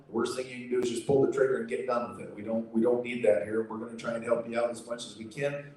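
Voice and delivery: usual speaking voice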